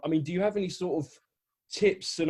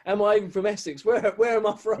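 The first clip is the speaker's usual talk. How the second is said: mock bewildered; 6 semitones higher, 6 dB louder than usual. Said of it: "And I was like".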